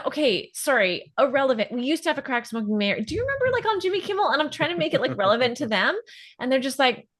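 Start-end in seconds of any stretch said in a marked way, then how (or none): none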